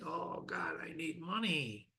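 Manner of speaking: soft, breathy mock longing for money; mock desperate murmur. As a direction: murmur it like a greedy man craving cash. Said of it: "people get attached to is money".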